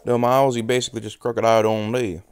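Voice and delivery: usual speaking voice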